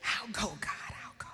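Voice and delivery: retold whisper of giving in; whispered surrender. Father giving in to God's call, whispered surrender. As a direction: whisper it, acting out a reluctant yes.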